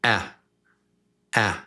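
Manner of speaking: clipped "a" like a boom; short A. Shown as a demonstration. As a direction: pop the "a" short. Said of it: "So short is just boom".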